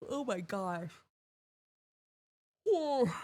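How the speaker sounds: yawning through the words. Real, not acted. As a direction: say it mid-yawn. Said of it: "I'm yawning so much".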